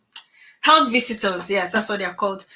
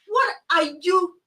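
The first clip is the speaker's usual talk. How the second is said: ordinary delivery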